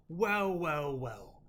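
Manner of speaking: lightly voiced smug broker; a hint of a sly dealer greeting them; smug NPC voice.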